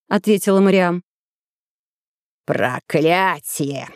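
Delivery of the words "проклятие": put-on low, slow, despairing old villain; old man voice. A narrator's reading. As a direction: drag it out in dread.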